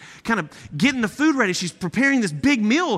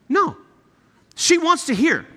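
usual speaking voice

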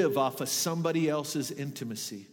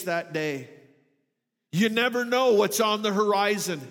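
higher, louder preaching to the congregation; full-force preaching; 6 semitones higher, 5 dB louder than usual. Acted. Pastor urging the congregation to build reserves, passionate full-force preaching.

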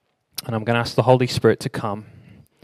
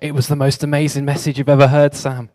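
excited; 4 semitones higher, 6 dB louder than usual. Retold or imitated. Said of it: "everyone was like".

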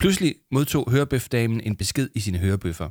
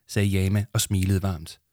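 ordinary delivery, nothing marked

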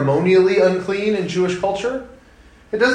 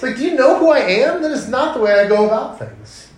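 much higher, louder indignation; indignant; 8 semitones higher, 3 dB louder than usual. Retold or imitated. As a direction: bristle at the insult. Indignant Jesus voiced.

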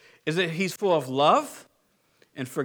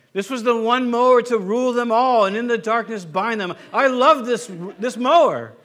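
epic hammed-up lament; 3 semitones higher, 6 dB louder than usual. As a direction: declaim the loss like an epic fantasy villain. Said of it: "start weeping and be like".

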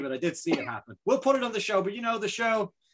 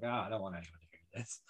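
embarrassed mumble; 7 semitones lower, 14 dB quieter than usual. Retold or imitated. Imitates embarrassed self.

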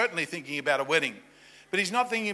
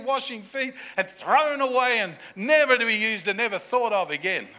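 ordinary talk

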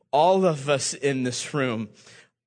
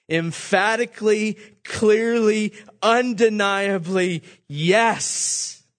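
emphatic build; 8 semitones higher, 6 dB louder, slower than usual. Acted. Preacher builds an emphatic declaration.

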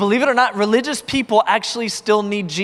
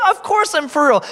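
eager insistence; 9 semitones higher, 3 dB louder than usual. Retold or imitated. Retold eager reassurance.

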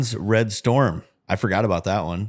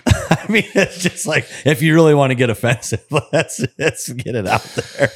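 giggling through the words, pitched up; laughing; 5 semitones higher, 4 dB louder than usual. Real, not acted. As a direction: laugh it out while talking. Laughing at own offensive joke.

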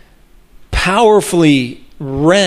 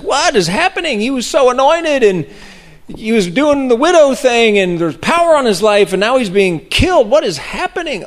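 much higher, pleading disbelief; bewildered outcry; 8 semitones higher than usual. Retold or imitated. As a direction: throw up your hands in high, baffled dismay. Mock bewilderment at Stephen's death.

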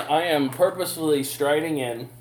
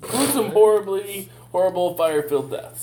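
gleeful doom; 6 semitones higher, 5 dB louder than usual. Acted.

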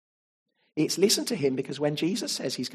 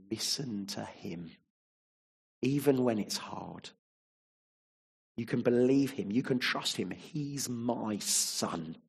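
soft, low, slow reassurance; tender God voice; 4 semitones lower, 4 dB quieter, slower than usual. Retold or imitated. Preacher voicing God tenderly reassuring the congregation, soft and low. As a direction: gently comfort the listener. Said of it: "And so God says".

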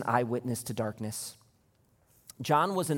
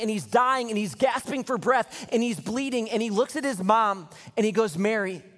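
higher, louder building preaching; rising sermon intensity; full-force preaching; 9 semitones higher, 5 dB louder than usual. Acted. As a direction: build the scene up for the church.

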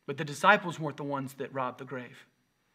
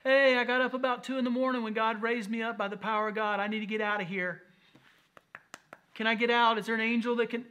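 lightly voiced, higher, anxious stuck man; mock Jesus voice; 7 semitones higher, 4 dB louder than usual. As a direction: play it as a silly, fretful prisoner. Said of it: "He wasn't in there going".

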